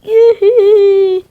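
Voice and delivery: high, loud whoop; high gleeful cry. Acted. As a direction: whoop with glee. Character flies off.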